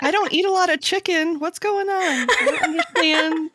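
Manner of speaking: high, loud mock confusion; baffled protest. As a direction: protest in bewilderment, laughter creeping in. Acts confused person called meter.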